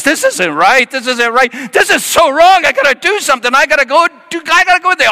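high, loud frantic pleading; a worried friend losing it at the door; high, loud, panicky guy; panicked friend. Retold or imitated.

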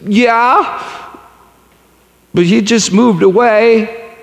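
put-on high little girl's voice; voicing his young daughter. Daughter's reply voiced in story.

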